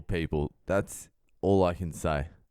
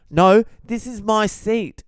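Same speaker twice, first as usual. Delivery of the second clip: very high, loud insistent stranger; high booming indignant voice; loud guy; 11 semitones higher, 6 dB louder than usual. Retold or imitated. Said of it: "He's like".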